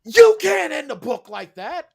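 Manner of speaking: high, loud, slow objection; indignant protest. Retold or imitated. Author retelling his wife's outrage at the ending, loud and indignant. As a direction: demand it like a wronged reader. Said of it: "She goes".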